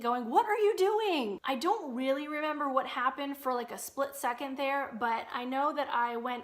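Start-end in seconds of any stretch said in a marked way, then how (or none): 0.3-1.4 high, loud yell imitating a panicked brother; high-pitched imitated yell; mock scream. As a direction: retell a brother's scream at someone being reckless. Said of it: "my brother is screaming at me".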